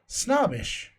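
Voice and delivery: normal talking voice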